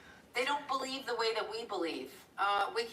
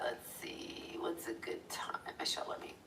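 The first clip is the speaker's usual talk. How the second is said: barely voiced thinking aloud while searching; whispered self-talk at the screen; muttering to herself; 10 semitones lower, 9 dB quieter than usual. Real, not acted.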